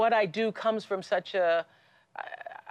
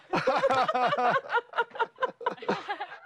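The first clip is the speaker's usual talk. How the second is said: high laughter. Real, not acted. Laughing at the joke.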